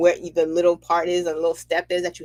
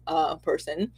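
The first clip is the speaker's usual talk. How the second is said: usual speaking voice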